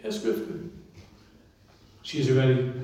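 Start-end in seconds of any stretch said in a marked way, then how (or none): 0.0-0.7 wife's voice, higher. Retold or imitated. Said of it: "She said".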